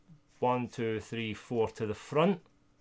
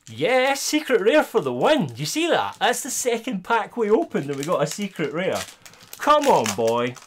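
higher and louder, triumphant cheer; celebrating; 8 semitones higher, 5 dB louder than usual. Real, not acted. Streamer shows chat the secret rare he pulled, triumphant cheer. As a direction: crow about the win to chat.

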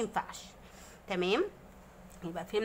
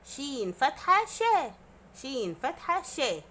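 slow spelling; 3 semitones higher, slower than usual. Shown as a demonstration. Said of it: "دي اسمها ايه".

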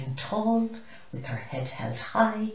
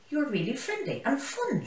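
a mild baboon shriek, pitched higher; 9 semitones higher than usual. Acted. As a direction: squeal it like a giddy baboon. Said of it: "shrieked Binny the baboon".

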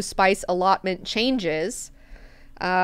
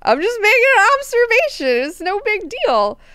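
panicked backpedaling; 14 semitones higher, 10 dB louder than usual. Retold or imitated. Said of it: "Mineo's like".

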